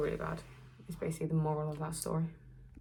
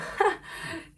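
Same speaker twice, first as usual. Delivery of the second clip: high, short laugh; real high-pitched giggle; amused laugh; 12 semitones higher than usual. Real, not acted. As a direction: burst of laughter at a funny memory. Laughs starting funny story.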